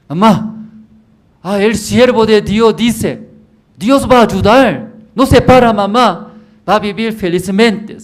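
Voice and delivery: high, loud, earnest pleading; urgent pleading. Acted. Son pleading with his mother to believe, high and loud. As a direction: plead with her to trust God.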